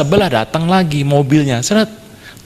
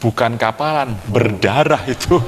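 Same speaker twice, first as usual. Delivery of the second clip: usual speaking voice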